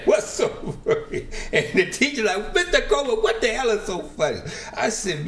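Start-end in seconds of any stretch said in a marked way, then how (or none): 0.0-1.3 high, breathy question squeezed out through laughter; laughing quote. Retold or imitated. 2.5-4.4 high-pitched exasperated teacher voice; teacher snapping at a giggling student; mock scolding teacher.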